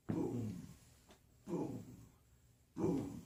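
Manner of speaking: loud low boom on each step; loud slow footstep boom. Acted. Monster climbing stairs.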